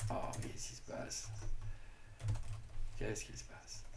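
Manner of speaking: very soft, whispery aside to self; barely voiced, puzzled self-talk; puzzled muttering. Real, not acted. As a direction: wonder under your breath. Puzzled at screen, talking to self.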